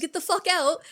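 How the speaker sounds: mock angry yell. Principal yelling retold.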